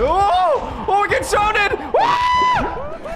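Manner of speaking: excited shout. Real, not acted. Zombies surround them in game.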